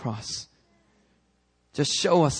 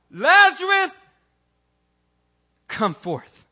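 high, loud call of the name; commanding call; 9 dB louder, slower than usual. Acted. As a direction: summon the dead man out. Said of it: "God did exactly what Jesus did".

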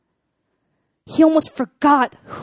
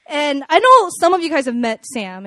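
ordinary talk

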